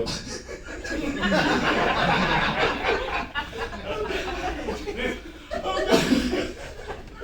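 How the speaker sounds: long, high, breathy mock sobbing; hammed-up breaking down in squeals; mock emotional breakdown. Salesman overcome by an easy sale, mock weeping. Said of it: "break down emotionally and go".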